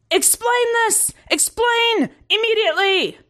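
very high, altered commander voice; demanding commander. A narrator's reading. Commander demanding answers from soldier, imperious. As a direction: order it in high, urgent exclamations.

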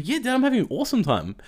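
brightly insisting, retold; happy kid. Voices his son's happy answer.

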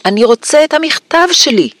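high-pitched insistent cry; shouted demand. A narrator's reading. Boy refusing to leave without his letter, high shouted demand. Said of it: "צעק".

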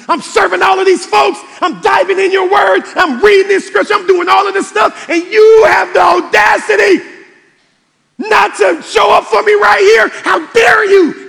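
full-force high shouting at God, re-enacted; angry outburst. Exhausted pastor accusing God of not showing up, furious shouting. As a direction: shout the accusation, punching every phrase. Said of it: "and it was anger".

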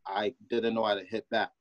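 ordinary talk, nothing marked

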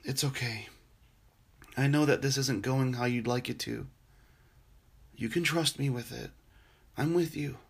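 soft, gentle comfort with long pauses; a hushed, steady voice soothing someone in pain; tender reassurance. Acted. Jesus comforting a suffering believer, softly reassuring.

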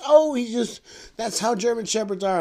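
high-pitched imitated woman's voice; old lady. Dog's owner excusing her German Shepherd, high mock old-lady voice. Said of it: "She goes".

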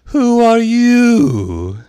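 very high, loud, slow and drawn-out; contemptuous caterpillar. A narrator's reading. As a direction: stretch it out with contempt. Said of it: "said the caterpillar contemptuously".

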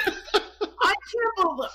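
half-laughing, can't get it out; laughing disbelief. Real, not acted. To friends who praise the movie, slow laughing disbelief.

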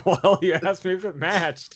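said through laughter, high and slow; laughing. Real, not acted. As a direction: laugh while defending yourself. Laughing reply to teasing question.

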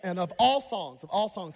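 ordinary talk, nothing marked

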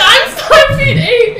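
very high, slow laughing statement; laughing reply. Retold or imitated. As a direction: spell it out slowly through laughter. Retold reply to child.

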